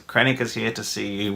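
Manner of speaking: low, soft, hushed announcement; ominous servant. Retold or imitated. Hooded figure quietly tells Vader that Krennic has arrived. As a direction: announce the visitor darkly and quietly.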